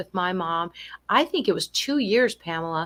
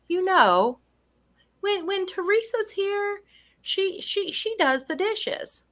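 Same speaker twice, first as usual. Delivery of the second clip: put-on much higher, slower old mother voice; a light impression of a confused mother; imitating mother; 11 semitones higher, slower than usual. Daughter voicing her mother praising another helper, higher. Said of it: "she might say".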